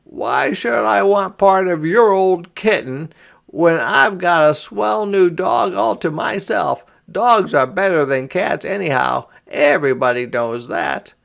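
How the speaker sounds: put-on high, loud bragging boy voice; boastful boy. A narrator's reading. Boy brags to his sister that dogs beat cats. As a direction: brag about your new dog. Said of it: "said James fiercely".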